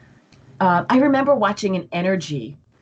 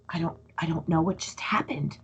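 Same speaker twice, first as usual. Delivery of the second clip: bewildered whisper, much softer; whispered confusion; 3 semitones lower, 7 dB quieter than usual. Retold or imitated. Guest recalling sudden overwhelm, whispering in confusion. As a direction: whisper it as if lost. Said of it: "I would start looking around like".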